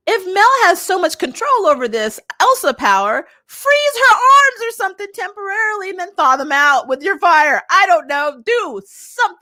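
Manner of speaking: high-pitched, worked-up pleading with the characters; exasperated rant. Real, not acted. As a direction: rant as if yelling advice at the screen. Ranting at characters' inaction.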